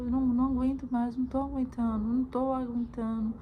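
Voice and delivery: normal talking voice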